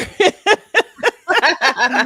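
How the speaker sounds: loud high laugh; high burst of laughter. Real, not acted. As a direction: cracks up loudly. Reacts to her own joke.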